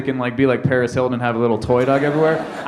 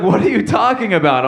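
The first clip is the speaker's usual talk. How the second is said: mad dog owner; 6 semitones higher, 3 dB louder than usual. Retold or imitated. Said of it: "so fucking mad".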